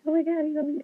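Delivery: giddy fangirl squeal; starstruck squeal. Retold or imitated. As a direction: jump way up high on oh my God. Jay-Z congratulated her.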